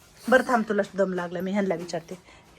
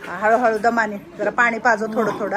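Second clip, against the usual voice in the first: ordinary talk, nothing marked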